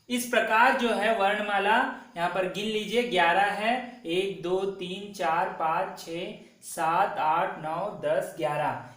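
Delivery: ordinary delivery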